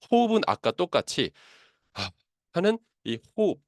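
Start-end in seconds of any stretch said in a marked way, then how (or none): 1.9-2.1 short exhaled "ha" of a sigh; sighing breath. Shown as a demonstration. Teacher shows the breath to use, a sighed exhale. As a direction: let the breath drop out as a sigh. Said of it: "이 한숨 쉬는 호흡으로 갑니다".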